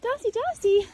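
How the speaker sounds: loud high call across a field. Real, not acted. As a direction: call the horse from afar. Calling horse across field.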